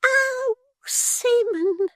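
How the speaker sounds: very high, slow, tearful fake fright; a martyr bidding farewell, squeaking; Miggs, faint and fearful. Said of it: "cried Miggs".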